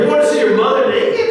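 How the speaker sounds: loud, high, quick mock disbelief; incredulous question. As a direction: voice an appalled challenge, shooting up in pitch. Krishna voiced questioning Duryodhana's plan.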